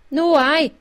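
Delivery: loud, high, triumphant pounce. A narrator's reading. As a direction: crow it as a point won. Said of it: "said Rob Anybody triumphantly".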